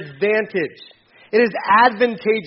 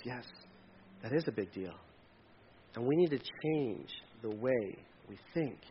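low, hushed, grave answer; quiet correction; 8 semitones lower, 16 dB quieter than usual. Acted. God correcting people who shrug off sin, quiet and low. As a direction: drop right down, soft and deliberate. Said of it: "And God goes".

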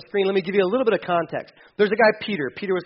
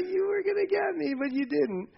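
jumps much higher, playful and soft; a cheeky caught-you squeal; teasing squeak; 10 semitones higher, 7 dB quieter than usual. Retold or imitated. Peter teasing God about the test, in a high playful squeak.

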